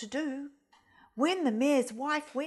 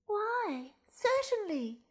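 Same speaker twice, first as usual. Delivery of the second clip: much higher bright exclamation; exclaiming goose; 10 semitones higher, slower than usual. Acted. Goose answers the fox brightly, much higher. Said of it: "exclaimed the goose".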